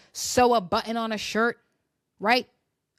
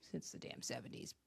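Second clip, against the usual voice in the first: whispered aside; 11 semitones lower, 22 dB quieter than usual. Real, not acted. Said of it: "mocking aside".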